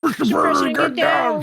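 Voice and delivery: high, loud mock bodyguard yell; bodyguard shout. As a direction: shout it like a secret agent. Echoing the bodyguard callout.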